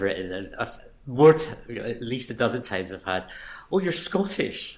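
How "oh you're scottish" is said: high, drawn-out surprise; imitated surprised reaction; surprised listener. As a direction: play someone startled by an accent, pitched right up. Acts out listeners' surprise at his accent.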